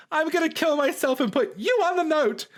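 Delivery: high, loud dramatic fan voice; mocking fan voice. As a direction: ham up an overwrought superfan. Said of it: "all the Phoebe stans are like".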